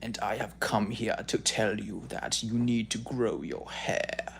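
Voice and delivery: slow, self-satisfied mock guru voice; hammed-up vain influencer, slow and grand; smug self-maxer impression. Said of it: "goes like this".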